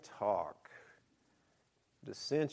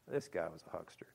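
hushed scornful whisper; 6 semitones lower, 8 dB quieter than usual. Real, not acted. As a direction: whisper it like a secret. Whispered aside.

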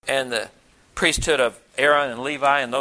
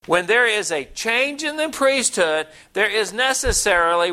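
higher, slower, building Bible reading; emphatic scripture reading; 6 semitones higher than usual. Acted. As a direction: proclaim the verse, pitched up and deliberate. Reads scripture aloud to congregation.

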